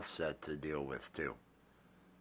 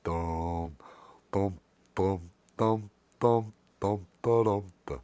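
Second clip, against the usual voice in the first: pumpkin voice demo; 4 dB louder than usual. Shown as a demonstration. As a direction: plod through the dums as the puppet. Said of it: "What if this were his voice?".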